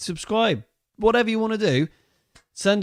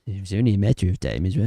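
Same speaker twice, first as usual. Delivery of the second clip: bottom jaw pushed out, low; underbite voice; 7 semitones lower, faster than usual. Retold or imitated. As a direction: talk with the lower jaw jutting. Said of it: "just gotta stick your bottom jaw out".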